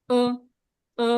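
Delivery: ও sounded from inside the throat; teacher's throaty demo of ও; throaty vowel. Shown as a demonstration. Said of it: "গলার ভেতর থেকে আসবে".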